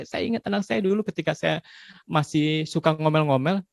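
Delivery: normal talking voice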